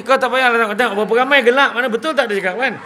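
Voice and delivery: loud, high laughing jab at the crowd; playful loud ribbing; loud teasing. Acted. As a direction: call the audience out, grinning.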